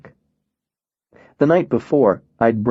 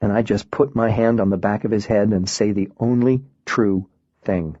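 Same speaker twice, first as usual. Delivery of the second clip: ordinary delivery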